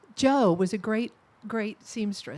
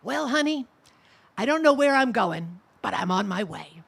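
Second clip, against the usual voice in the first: hint of rasp, warmly recalling her favourite line; Joe's raspy voice; 6 dB louder than usual. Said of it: "in that raspy voice of hers".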